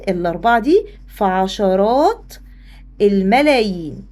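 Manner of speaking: slow teaching emphasis; slow, deliberate answer. Shown as a demonstration. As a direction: spell the answer out slowly. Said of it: "stresses the answer for class".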